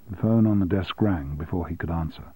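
ordinary delivery

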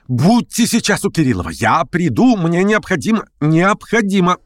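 high-pitched, insistent plea; beg, pressing the need; urgent pleading. A narrator's reading. Verkhovensky begs Stavrogin to meet him, urgently.

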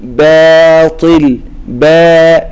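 باطل stretched very slowly with a heavy baa; باطل drawn out, the baa said full and heavy; correct pronunciation. Shown as a demonstration. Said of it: "بهذه الطريقة". (نطق صحيح لكلمة باطل بباء مفخمة ممدودة)

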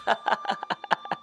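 very high, soft staccato giggle; storyteller's squeaky acted laugh; acted burst of laughter. A narrator's reading. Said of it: "હાથી અને કીડી બેઉ હસી પડ્યા".

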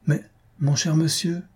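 high, mildly flustered protest; stammering. A narrator's reading. Clockmaker to scolding host, stammering. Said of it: "balbutia Blanquette".

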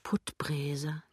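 soft whisper to herself; musing, whispered inner voice; whispered thought. A narrator's reading. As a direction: whisper the name as a private thought. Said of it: "denkt sie".

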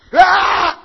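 high, loud acted scream; sudden wail of the damned; scream of torment. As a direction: scream out as if burning. Imitated scream from hell.

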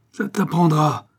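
a hint of breathless father's triumph; slightly out of breath. A narrator's reading. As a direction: huff it out after the beating. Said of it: "et un peu haletant".

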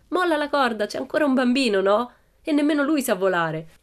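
high, loud call to the crowd; shouting down. A narrator's reading. Pippi calling to the crowd below, loud shout. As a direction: shout down from the tree. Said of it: "Ma Pippi gridò".

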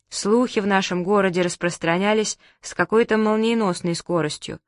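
ordinary delivery

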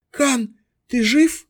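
high, faltering call; weak, slow call. A narrator's reading. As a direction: call out weakly, slowly. Exhausted girl calls to fallen friend.

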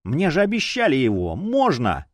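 high, insistent begging; pleading. A narrator's reading. Champion begs the chief for the detective, pleading. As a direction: beg like someone promised a treat. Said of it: "с мольбой посмотрел на главного".

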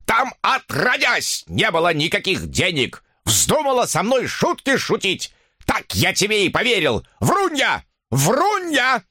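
put-on high, screechy old man's rant; furious squealing accusation; enraged yelling. A narrator's reading. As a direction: rail at her, pitch leaping up. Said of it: "вопил он".

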